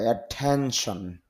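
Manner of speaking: Attention with the stress on টেন, said slowly; stress on ten. Shown as a demonstration. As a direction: lean on the টেন in attention, slow and clear. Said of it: "stress mark আছে, তাই চাপ দিতে হবে". (Attention শব্দে টেন-এ জোর দিয়ে ধীরে বলুন)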